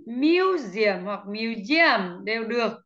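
museum said two ways, slowly. Shown as a demonstration. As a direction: show both readings of museum, clearly. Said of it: "chúng ta có thể đọc hai cách".

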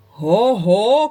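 loud mock boom; exclaiming. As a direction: bellow it like a big bear. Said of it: "крикнув він".

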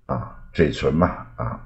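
ordinary talk

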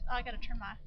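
ordinary talk, nothing marked

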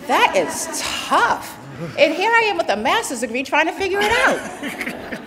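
laughing while talking. Real, not acted. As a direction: say it through a big laugh. Laughing at own situation.

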